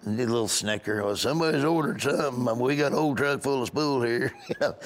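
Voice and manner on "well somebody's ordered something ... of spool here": comic cable installer character; cable installer guy. Acted. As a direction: answer as the joking installer. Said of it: "I did this cable installer guy".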